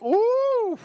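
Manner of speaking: high, drawn-out "ooh"; excited whoop. Real, not acted. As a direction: let out a long, high, delighted ooh. Excited over the loaded mash.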